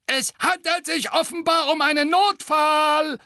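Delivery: very high, loud shrill taunt; sneering gargoyle. A narrator's reading. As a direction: jeer at him in a shrill shriek. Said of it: "höhnte der zweite Wasserspeier". (höhnischer Wasserspeier, schrill und laut)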